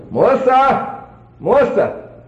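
high calling out for his wife. Retold or imitated. Husband calling for his wife in the trailer, high call. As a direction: call her name across the trailer.

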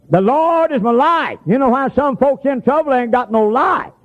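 high, ringing proclamation with drawn-out words; preaching. Real, not acted. Preacher driving home the verse to church, high proclaiming. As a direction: lift it high and declare it to the congregation.

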